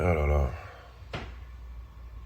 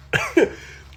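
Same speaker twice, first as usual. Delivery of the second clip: burst of laughter; 4 dB louder than usual. Real, not acted. Mocking the rapper's hypocrisy.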